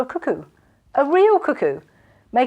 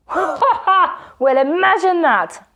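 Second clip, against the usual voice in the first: mocking laugh; 10 semitones higher, 6 dB louder, slower than usual. A narrator's reading. Robber laughs.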